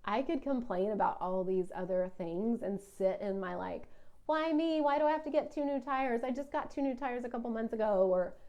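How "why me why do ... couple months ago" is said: high, fast whining complaint; whiny voice. Pretend. Podcaster mocks her own complaining in a whiny voice. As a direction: whine like life is so unfair. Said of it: "you love my whiny voice".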